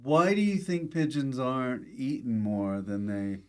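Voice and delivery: ordinary talk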